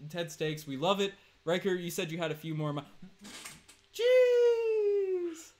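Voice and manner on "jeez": surprised shout. Real, not acted. Sudden shout at super chat.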